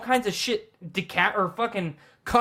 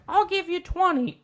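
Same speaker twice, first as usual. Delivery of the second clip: put-on old lady voice; 12 semitones higher than usual. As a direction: quaver it like a stingy grandma. Neighbor grandma haggling over mowing.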